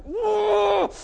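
high, loud "whoa" of fury; mock yell of a boy losing his temper; enraged yell. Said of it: "I was so mad. I was like".

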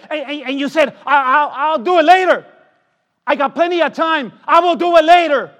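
loud, high preaching with shouted pleas; a preacher warning sinners of the lake of fire, shouting; fiery preaching. Acted. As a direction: warn them at full force. Preaching at full force.

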